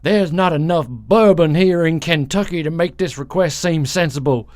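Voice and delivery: put-on Kentucky accent. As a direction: read it in a broad Kentucky accent. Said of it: "I'm gonna read it in my Kentucky accent".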